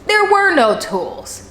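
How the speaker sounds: slow, high-pitched outcry on "There were no tools"; emphatic indignation. Real, not acted. Indignant reveal of the scam.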